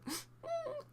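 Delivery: sharp, high startled gasp; shocked gasp. Retold or imitated. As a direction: gasp as if caught off guard. Retold shock at hand grab.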